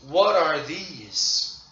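slow model question. Shown as a demonstration. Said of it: "Everyone together".

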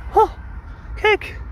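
squeaky high voice, way up; very high pitch. Acted. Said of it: "Waarom praat je zo hoog?".

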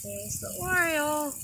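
ordinary talk, nothing marked

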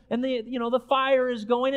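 normal talking voice

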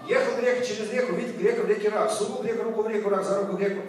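tongue twister. Shown as a demonstration. Performer shows the audience a Russian tongue twister, rattled off. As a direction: rattle it off without tripping. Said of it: "Next tongue twister".

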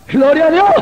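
loud, high burst of praise; grito de alabanza. Real, not acted. Sudden cry of praise after story. (alabanza repentina a gritos, aguda)

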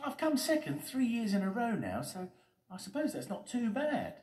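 very high character voice; high, earnest storybook hero; Steady Eddie, resigned. Acted.

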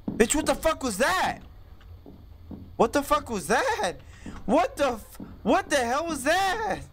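high, shocked outburst. Real, not acted. Gamer reacting to a jump scare, high and shocked. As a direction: demand it in disbelief, pitched high. Startled by monster.

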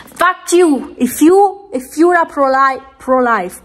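high-pitched, angry curse; furious outburst. Real, not acted. Vlogger cursing pro-life viewers, furious. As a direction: burst out at them, pitch jumping up.

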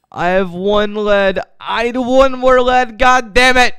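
high, loud frustrated yell; exasperated outburst. Real, not acted. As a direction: complain loudly, pitch climbing. One lead short, exasperated.

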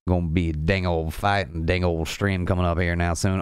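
hammed-up country Southern accent; playful Southern backwoods talk. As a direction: run the words together in a Southern twang. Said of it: "Doing southern accent now".

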